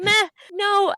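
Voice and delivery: high sneering mimic of a shrug-off; mock dismissive. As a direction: mimic her careless verdict. Mocking friend's dismissive verdict.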